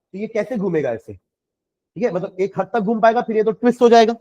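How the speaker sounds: normal talking voice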